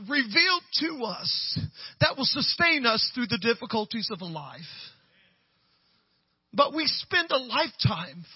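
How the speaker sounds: high, loud, drawn-out exhortation; impassioned preaching. Real, not acted. Pastor urging congregation not to lose joy, impassioned preaching. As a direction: preach it at full pitch, leaning on each phrase.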